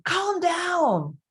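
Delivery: imitating a frazzled parent yelling at a child; mock yelled calm down. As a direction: bark it like a stressed parent losing patience. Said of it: "if you're saying".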